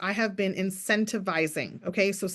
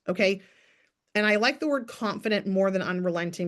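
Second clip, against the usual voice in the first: ordinary talk, nothing marked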